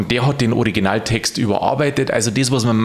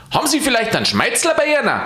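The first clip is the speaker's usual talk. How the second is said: fast, much higher shouted ask; shouted question; 12 semitones higher, faster than usual. Acted. Alois shouts to the saint asking for snuff, fast and high. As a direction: rattle off the request. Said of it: "schrie Aloysius".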